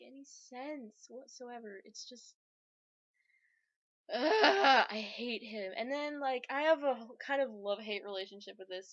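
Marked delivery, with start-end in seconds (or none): 4.1-4.9 disgusted groan. Real, not acted.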